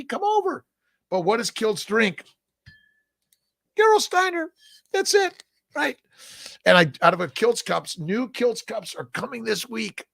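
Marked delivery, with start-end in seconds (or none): 0.0-0.6 squeaky high invitation; playful falsetto. Pretend. Inviting viewers over for coffee, in a teasing falsetto. 3.8-6.0 squeaky high invitation; playful falsetto. Pretend. Inviting viewers over for coffee, in a teasing falsetto.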